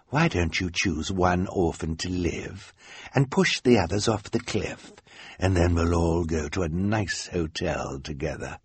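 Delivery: hushed, syrupy villain coaxing; sickeningly sweet. A narrator's reading. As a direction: make a cruel offer sound sugary. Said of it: "in a sickeningly sweet voice".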